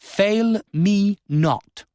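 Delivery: a countess's final order to her lord, each word stressed; emphasis on each word. A narrator's reading. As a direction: space out and stress every word. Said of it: "with an emphasis on each word".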